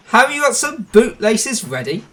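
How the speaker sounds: rude teasing. A narrator's reading. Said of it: "laughing rudely".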